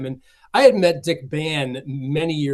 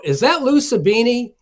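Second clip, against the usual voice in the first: calling out; 9 semitones higher, 6 dB louder than usual. Retold or imitated. Guest calling out at door.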